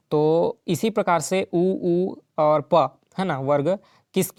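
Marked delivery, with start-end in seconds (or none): 1.6-3.0 उ and प said very slowly, lips rounding then closing; teacher slowly shaping उ and प with the lips; lip sounds demo. Shown as a demonstration. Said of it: "जैसे उ बोलेंगे तो ओठ का जो शेप है".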